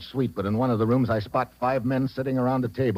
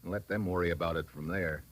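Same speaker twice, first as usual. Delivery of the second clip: softer, drained sign-off; weary relief; 4 semitones lower, 8 dB quieter than usual. Acted. Detective leaves Robertson, drained and soft with relief. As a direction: exhale after the danger. Relieved exit after bomb.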